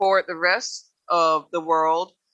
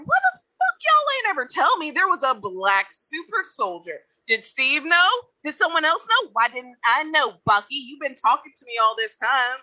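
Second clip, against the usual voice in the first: indignant Sam; 6 semitones higher than usual. Acted. Acting Sam's confrontation with Bucky.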